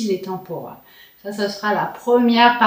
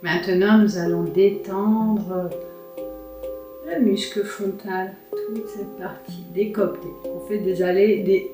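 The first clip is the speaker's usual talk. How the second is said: sultry narrator. Acted. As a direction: guide them gently into calm. Leads a face yoga relaxation.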